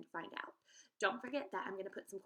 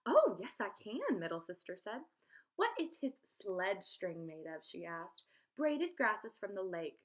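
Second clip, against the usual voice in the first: lively read-aloud storytelling voice; story read aloud; 2 semitones higher, 5 dB louder than usual. A narrator's reading. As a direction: read the tale to young children, bright and clear. Teacher reads story aloud.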